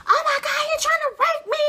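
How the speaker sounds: high, loud, frantic cry for help; imitating her cousin screaming down the phone; panicked shout. Cousin on the phone begging for help, panicked shout.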